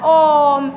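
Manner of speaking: ओम with the "o" held on one level note; prolonged pluta vowel. Shown as a demonstration. Teacher showing students the triple-length vowel, prolonged pluta vowel. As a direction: draw ओम out like a chant. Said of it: "जैसे आप बोलते हो ना".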